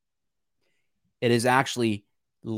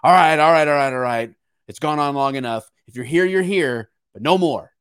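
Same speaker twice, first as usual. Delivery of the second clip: mock official voice, louder at the all rights; hammed-up politician announcing a fresh start; playing a weary lawmaker waving everyone through; 3 semitones higher, 6 dB louder than usual. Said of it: "I think maybe they're like".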